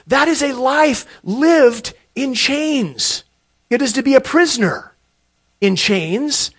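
high, loud pulpit proclamation; emphatic preaching. Real, not acted. Preaches on chains and bondage.

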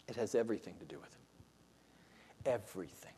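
whispered, soft and low; hushed whisper. Real, not acted. Preacher leaning in to congregation, hushed whisper. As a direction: drop to a whisper to drive it home. Hushed emphasis after question.

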